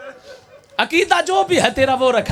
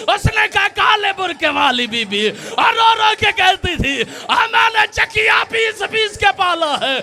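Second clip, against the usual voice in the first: preaching at full force; 9 semitones higher than usual. Acted. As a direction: drive the grief to its peak. Climax of martyrdom narration. (पूरे ज़ोर से ऊँची, धीमी मातमी सदा)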